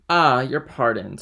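loud, slow, stiff apology; emphatic, displeased begging of pardon; frowning apology. A narrator's reading. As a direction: say it slowly with a frown in the voice. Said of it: "frowning in his turn".

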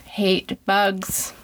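drawn out. Real, not acted. Stresses dislike.

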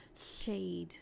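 "seed" with air hissing out the sides, like "sheed"; slushy side-flowing s in "seed"; lateral lisp. Shown as a demonstration. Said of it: "when I have a lateral posture ... this is what it sounds like".

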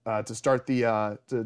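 ordinary delivery

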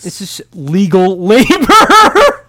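slow, laughing through the words. Real, not acted. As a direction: crack up on labor. Joking about forced labor.

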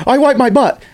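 squeaky mock Biden line; high mock blurt. Mocking Biden's gaffe to a friend, pitched high. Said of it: "and it's like".

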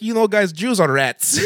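high, loud mock Russian-accented quote; exaggerated mock Russian accent; Russian guy. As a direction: ham up the bandmate's Russian accent. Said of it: "It's like".

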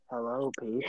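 whispered low monotone. Shown as a demonstration. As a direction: breathe it out low and even. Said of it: "whispers in a low monotone voice".

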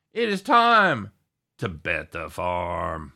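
announcer voice. Acted. Catchphrase segment intro.

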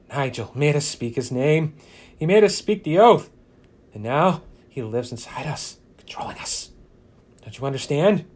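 frantic, pleading cultist. Acted. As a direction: ramble it out in a panic. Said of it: "he exclaims frantically".

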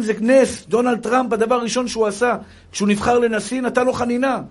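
high, loud, fervent sermon; impassioned preaching. Real, not acted. Rabbi urges faith amid hardship, impassioned preaching. Preaches Rubashkin story at raised pitch.

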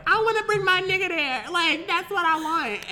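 high-pitched acted-out pleading; high, put-on wishful insistence; mock insistent demand. Acts out her younger self's demand.

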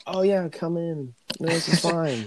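imitating blithely unbothered staff; casual welcoming officials. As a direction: wave them through, drawn out and easygoing. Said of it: "And they're like".

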